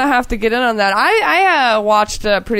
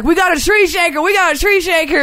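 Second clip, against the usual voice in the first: higher-pitched excited gator hunters, acted out; imitated frantic excitement; 6 semitones higher than usual. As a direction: mimic hunters raising the alarm. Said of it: "all fucking going ape shit over it".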